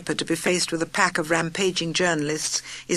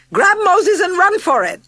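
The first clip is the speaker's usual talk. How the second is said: frantic shriek, much higher and louder; 12 semitones higher, 8 dB louder than usual. A narrator's reading. Aunt Doris shrieking at Tipperary as the bus tips, panicked. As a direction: scream it in panic. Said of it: "shrieked Aunt Doris".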